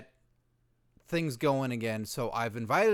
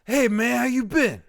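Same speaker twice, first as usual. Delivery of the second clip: high, loud hollered hello; hollered buddy greeting; hearty loud greeting; 6 semitones higher, 6 dB louder than usual. Acted. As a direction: greet an old pal at full volume. R-Truth greets his friend loudly.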